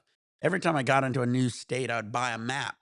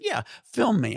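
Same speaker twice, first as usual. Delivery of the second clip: higher, louder mock challenge; retold defiant dare; 7 semitones higher, 5 dB louder than usual. Storyteller replays a dare to the driver with a camera, defiant. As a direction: dare them to do it, acting it out.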